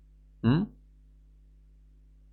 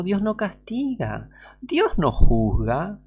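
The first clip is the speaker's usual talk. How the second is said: serpent's lie; 8 semitones higher, much slower than usual. Retold or imitated.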